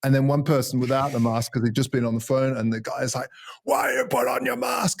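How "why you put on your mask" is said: shouted high in a mock German accent; angry German voice. German accent, shouting at a maskless man in a supermarket. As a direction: bark it like an angry German shopper. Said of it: "in a full, like, German angry s-, voice".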